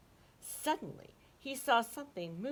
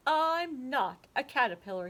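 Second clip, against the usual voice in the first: Hugo insisting; 5 semitones higher, 6 dB louder than usual. Acted. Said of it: "Hugo told her".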